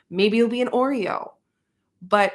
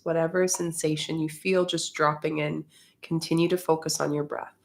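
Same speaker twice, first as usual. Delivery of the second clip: guided meditation; 3 semitones lower, 5 dB quieter than usual. Acted. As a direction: ease them gently into the breath.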